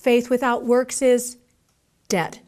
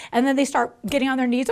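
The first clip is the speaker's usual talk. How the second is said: ordinary talk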